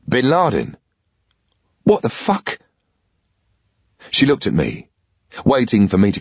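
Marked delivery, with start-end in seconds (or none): 0.1-2.6 jolted up high on "What the fuck"; mock-stunned outburst; shocked disbelief. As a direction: caught off guard by the name. Nick shocked at the news.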